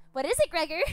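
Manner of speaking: loud, high sneering question; mocking captor. Captor taunting the wounded Gregor, loud mocking. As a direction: taunt him by name.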